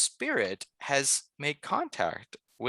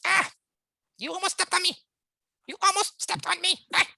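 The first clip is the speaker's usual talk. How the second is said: tiny high-pitched manic critter voice; manic little voice; 4 dB louder than usual. Acted. Little creature scolds the one who nearly stepped on it, manic. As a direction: squeak it out with frantic energy. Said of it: "almost manic voice, just really energetic".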